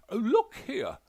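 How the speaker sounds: high, loud indignant protest; indignant gentleman. A narrator's reading. Widower protests to the detective, high and loud. Delamere shifts defensively.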